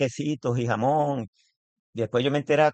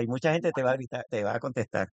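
normal talking voice

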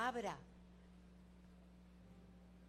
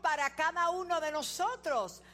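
high, loud and slow preaching climax; fervent pulpit proclamation; preaching at full force; 9 semitones higher, 8 dB louder, slower than usual. Acted. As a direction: drive it home to the congregation.

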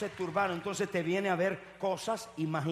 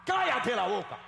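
higher, loud command to shut up; shouted rebuke; 5 semitones higher, 6 dB louder than usual. Acted. Preacher telling screaming cowards to shut up, shouted rebuke.

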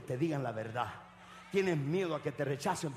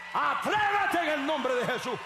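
high, forceful preaching shout; fiery exhortation; 8 semitones higher, 6 dB louder than usual. Real, not acted. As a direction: cry out a bold command to the congregation. Preacher exhorts congregation to dare.

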